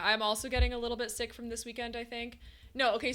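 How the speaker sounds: ordinary delivery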